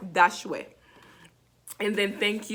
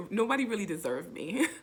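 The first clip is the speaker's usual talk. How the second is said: ordinary talk, nothing marked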